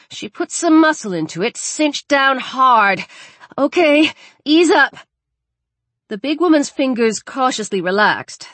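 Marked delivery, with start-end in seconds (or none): 3.6-4.9 higher, louder order; tense raised voice; urgent instruction. Acted. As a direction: call out to a helper mid-emergency. Urgent first aid instruction.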